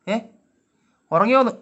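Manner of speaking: loud, high demand for an answer; insistent questioning. Real, not acted. Repeats question to the cat.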